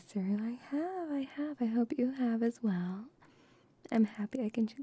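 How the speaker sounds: usual speaking voice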